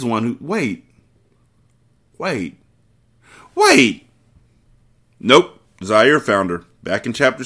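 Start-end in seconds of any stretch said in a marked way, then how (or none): 3.5-4.1 sudden surprise. Real, not acted. Realizes mistake mid-recap.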